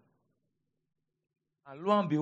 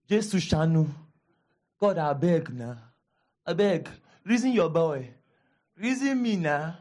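loud hammed-up pleading with God; mock begging prayer; 4 dB louder than usual. Pastor mocking how people pray, loud mock pleading. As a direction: beg God like a desperate bargainer, louder. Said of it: "this is how some people, their Christianity is".

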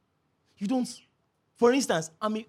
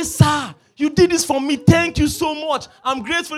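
loud, higher, gushing gratitude; effusive thanks; 6 semitones higher, 7 dB louder than usual. Acted. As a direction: pour out thanks at full voice, hammed up. Acted gushing thanks to a helper.

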